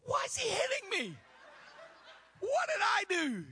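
high, loud startled cry with a laugh; alarmed surprise. Retold or imitated. Preacher retelling his shock at being hit, mock alarmed cry. Alarmed inner thought retold.

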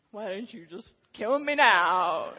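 loud, slow mock plea; mock despair. Preacher groaning at God's demand, loud slow mock wail.

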